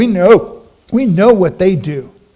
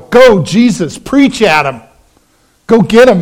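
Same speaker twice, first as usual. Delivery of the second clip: cheering on; 2 semitones higher, 4 dB louder than usual. Retold or imitated. Crowd cheering Jesus on.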